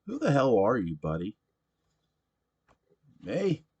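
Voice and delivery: put-on loud, high rowdy drunk voice; a belligerent barfly demanding his beer; drunk pushy patron. Sam answers and argues.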